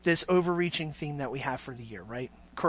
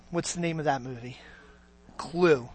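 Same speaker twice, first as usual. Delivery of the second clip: ordinary talk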